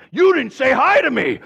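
mock the petty grievance; aggrieved complaint. Angry man repeating his complaint to the pastor, loud aggrieved. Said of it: "angry man".

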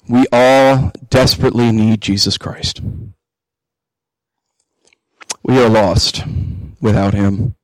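earnest pastor's prayer, unhurried and reverent; closing prayer. Acted.

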